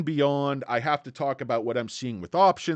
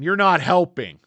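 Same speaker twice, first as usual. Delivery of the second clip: mock scolding; 6 semitones higher, 11 dB louder than usual. Teasing a viewer about Mondays.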